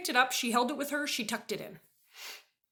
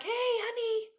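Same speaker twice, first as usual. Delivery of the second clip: much higher, coaxing "Hey, honey"; sing-song sweet talk; 11 semitones higher, 4 dB quieter, slower than usual. Retold or imitated. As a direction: coo at him like a child. Sweet-talking husband.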